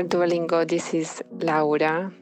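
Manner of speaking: ordinary delivery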